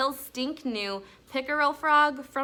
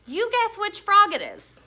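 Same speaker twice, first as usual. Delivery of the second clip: excited; 10 semitones higher, 7 dB louder than usual. A narrator's reading. Judy explaining the game eagerly.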